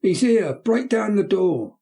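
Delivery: frantic high shouting; boy shrieking. Acted. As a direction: scream it out to the crowd outside.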